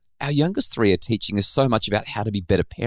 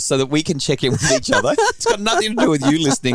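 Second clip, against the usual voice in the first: usual speaking voice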